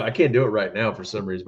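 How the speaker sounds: ordinary delivery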